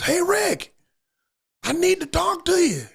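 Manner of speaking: urgent call. Retold or imitated. Shirtless neighbor calls Rick over in a panic, urgent. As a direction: call across the yard, worried.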